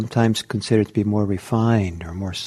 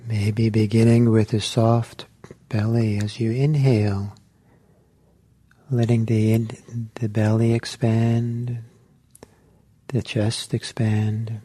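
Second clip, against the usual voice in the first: guided meditation. Acted. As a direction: ease the group into stillness, one gentle cue at a time. Teacher leads a guided meditation.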